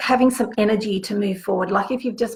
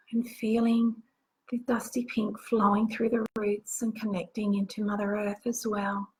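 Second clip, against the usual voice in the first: guided meditation; 5 dB quieter than usual. Acted. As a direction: guide them gently into the visualization. Leads a guided visualization.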